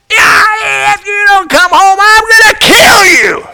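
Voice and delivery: high, loud screaming mother; furious threat. Retold or imitated. Mother's last furious yell at the boy. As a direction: scream the threat, at the end of her rope. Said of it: "and she said".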